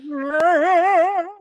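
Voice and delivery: high squealed no; mock yell. The fleeing priest, a high mock yelp.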